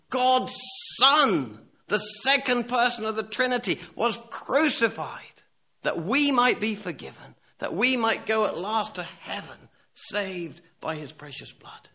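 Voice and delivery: high, emphatic proclamation with weighty pauses; impassioned preaching. Real, not acted. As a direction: proclaim it fervently to the congregation.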